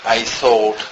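normal talking voice

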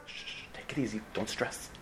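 soft breathy shushing; hushing whisper. Acted. Nurse hushing a panicked patient, whispering. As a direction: hush him down in a whisper.

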